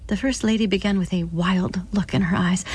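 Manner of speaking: ordinary delivery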